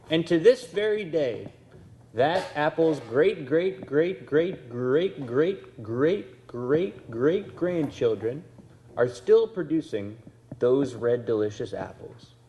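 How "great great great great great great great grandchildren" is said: ordinary talk, nothing marked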